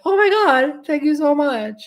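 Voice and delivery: very high-pitched, hammed-up gratitude; gushing thanks. Acted gushing reply to a compliment.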